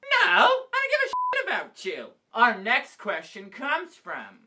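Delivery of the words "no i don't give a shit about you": very high-pitched mock indignation; hammed-up rejection. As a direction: dismiss the friend in a squeal. Comic rejection of best friend.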